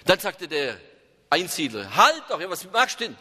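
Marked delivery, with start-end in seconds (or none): 2.0-3.2 higher, urgent cry of alarm; alarmed protest. Acted. Hermit cries out as the companion burns the house. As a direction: stop him as the house catches fire.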